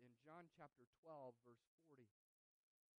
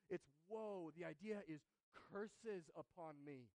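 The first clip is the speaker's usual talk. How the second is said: usual speaking voice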